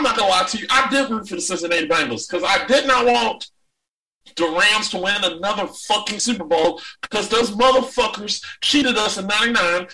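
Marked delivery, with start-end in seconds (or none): none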